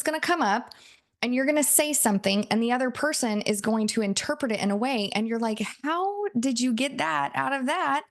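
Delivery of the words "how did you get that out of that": baffled. Acted. Acted baffled reply to misinterpretation.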